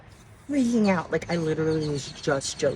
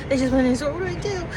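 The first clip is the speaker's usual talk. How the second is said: higher, breathless excited panic; freaking out; 10 semitones higher, 5 dB louder, much faster than usual. Real, not acted. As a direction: blurt it out in giddy panic. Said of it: "I'm freaking out".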